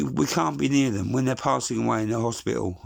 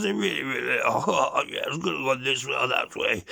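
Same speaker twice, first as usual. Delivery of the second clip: Boris impression. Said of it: "gets straight on the camera".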